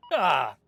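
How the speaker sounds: sudden loud "ah" shout; loud pirate cry. Acted. Pirate clears in loudly.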